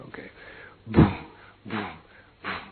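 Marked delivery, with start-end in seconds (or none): none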